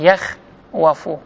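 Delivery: یەخوەفو slowly as yakh... wafu; underlying form. Shown as a demonstration. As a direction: split یەخوەفو after yakh and sound the w. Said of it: "وەکوڵێن یەخوەفو".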